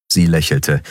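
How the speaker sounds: usual speaking voice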